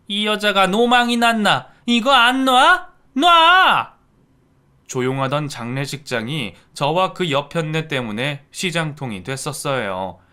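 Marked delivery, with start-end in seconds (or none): 0.1-3.9 loud, rising high yell of let go; furious shout. Acted. In-law screaming at the mother to let go. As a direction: scream it, climbing to the last word.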